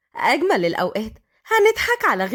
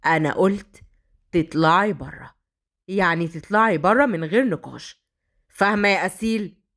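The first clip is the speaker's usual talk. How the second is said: hellish rage; 6 semitones lower than usual. Acted. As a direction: threaten her, every word weighed. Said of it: "بغضب جحيمي".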